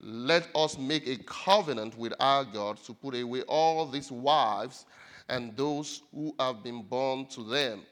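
slow, loud, emphatic Bible reading; scripture reading. Acted. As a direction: proclaim the passage slowly, punching the key words. Resumes reading scripture aloud.

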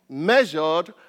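usual speaking voice

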